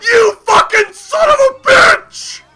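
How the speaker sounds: loud, slow, high-pitched yelling; enraged insult. Real, not acted. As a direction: scream it at the player, drawing out the words. Screaming insults at another player.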